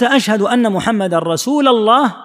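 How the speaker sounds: "رسول" said "rasuula-llah", left hanging; fatha on rasul. Shown as a demonstration. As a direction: end "رسول" on an open "la" before "الله". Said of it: "لا ينصب اللام". (فتح لام «رسول»: رسولَ الله)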